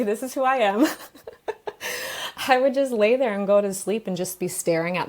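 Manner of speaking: usual speaking voice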